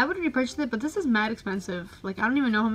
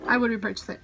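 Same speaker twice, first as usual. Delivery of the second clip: ordinary talk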